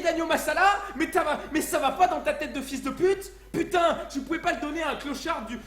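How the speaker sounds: very loud, high-pitched furious rant; outraged shouting. Acted. Customer learns restaurant binned his curry, outraged shouting. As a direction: explode in disbelief and yell abuse.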